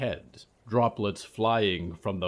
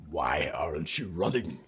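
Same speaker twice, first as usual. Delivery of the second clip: low growl of a swamp monster; growling creature; 4 semitones lower than usual. Acted. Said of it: "he growled".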